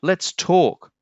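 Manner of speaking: high, loud earnest plea; pleading. Acted. Rainbow Fish pleading with the whale, high and earnest. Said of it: "said Rainbow Fish".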